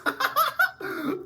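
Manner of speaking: high, bursting laugh of real excitement; excited laugh. Real, not acted. Vlogger to his viewers, pumped up, bursting laugh. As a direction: laugh out the hype. Hyped laugh after heated speech.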